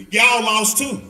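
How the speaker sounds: loud laughing jab; loud teasing comeback to a bragging lady; mocking retort. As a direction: shout the punchline through a laugh.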